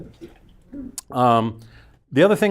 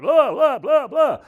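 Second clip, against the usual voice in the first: high, excited mock yell; imitating yelling over-excited; 14 semitones higher, slower than usual. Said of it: "I get excited at the beginning, and I'm yelling".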